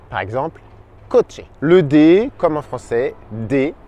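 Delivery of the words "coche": "coche" with ch said as tch; CH espagnol. Shown as a demonstration. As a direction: say "coche" in Spanish, with a tch in the middle. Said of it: "ça donne ch". (« coche » avec le son tch)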